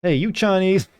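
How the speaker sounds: mock broken-English shout; hammed-up accented yell. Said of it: "Should I be reading this in a broken accent".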